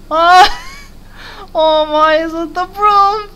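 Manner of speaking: melting high coo; cute cooing. Real, not acted. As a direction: gush at it like at a puppy. Cooing at character sweeping.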